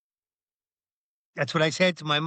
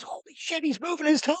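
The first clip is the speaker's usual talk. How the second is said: high-pitched astonished exclamation; amazed mock outcry, voice jumping up; roommate's amazement; 13 semitones higher than usual. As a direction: gasp it out in disbelief. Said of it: "he looks over, he goes".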